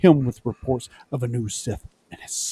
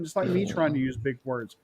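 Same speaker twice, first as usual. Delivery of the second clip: ordinary talk, nothing marked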